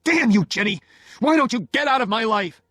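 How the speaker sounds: loud, high outburst of rage; husband exploding at his wife; furious shout. Acted. Oliver explodes, throws the telephone.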